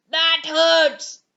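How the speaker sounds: loud, pained beast complaint; complaining. Acted. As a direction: whine it like a wounded brute. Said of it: "he complained".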